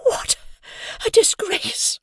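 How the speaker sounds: breathless, whispered gasp; out of breath. Acted. Missus Hamilton after dragging Hoggy off, breathless. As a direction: gasp it, winded from the struggle. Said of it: "out of breath".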